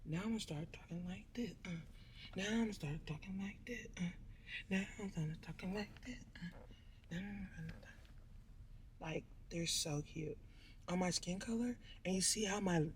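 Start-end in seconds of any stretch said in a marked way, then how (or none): 0.1-8.0 breathy whisper with no voice. Shown as a demonstration. Whispering to viewers while at the nail salon. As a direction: whisper it like a secret.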